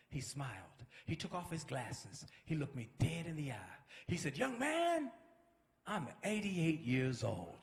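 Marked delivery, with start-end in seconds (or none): 4.4-5.1 high, slow, drawn-out address; imitating an elder getting attention; older gentleman calling. Older minister addresses him again. 5.9-7.6 low, very slow, measured statement; older gentleman's reveal. Retold or imitated. Older minister reveals his age.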